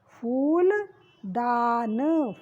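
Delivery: फूलदान slowly, long ऊ; slow teaching read. Shown as a demonstration. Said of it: "फा पे ऊ की मात्रा लगी". (फूलदान धीरे धीरे)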